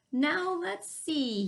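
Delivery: slow, drawn-out musing; pondering. Acted. Buddy pondering alone at the end of the road, slowly. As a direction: think it over aloud, slowly. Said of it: "he pondered".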